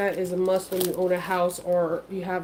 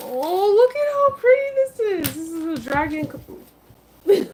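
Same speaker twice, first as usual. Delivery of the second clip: excited; 9 semitones higher, 5 dB louder than usual. Real, not acted. Delight at unboxing.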